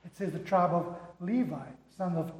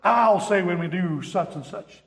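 bossy hound dog; 8 dB louder, slower than usual. Retold or imitated. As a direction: play the pompous dog who insists he leads. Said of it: "those two dogs, one hound dog".

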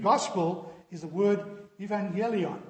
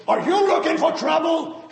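much higher, loud gruff yell; sergeant bellowing; 10 semitones higher, 10 dB louder than usual. Acted. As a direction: roar the question across the desk. Sergeant punchline question.

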